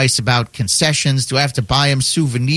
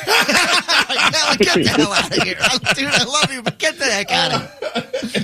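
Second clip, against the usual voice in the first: high-pitched, laughing mock send-off; laughing dismissal; 6 semitones higher than usual. Real, not acted. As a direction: crack up while kicking the caller out. Host cracks up at flat greeting.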